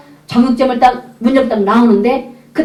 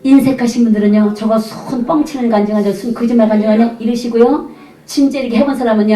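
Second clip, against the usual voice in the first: ordinary talk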